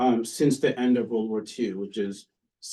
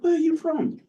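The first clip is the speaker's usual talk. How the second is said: very high-pitched mock Oxford English; British Oxford accent. British professor asking a student's origins, exaggerated Oxford accent. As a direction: imitate an inquisitive old British professor, pitched way up. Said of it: "this is my best British Oxford accent".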